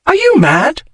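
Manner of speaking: sharp high outburst of disbelief; shocked cry. A narrator's reading. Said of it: "she cried".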